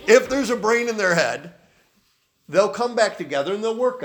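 amused, laughing repeat. Real, not acted. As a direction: laugh your way through the line.

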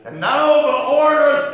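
a full-throated shouting preacher; preaching at full force. Acted. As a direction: roar it from the pulpit. Preaching battle climax, shouting orders.

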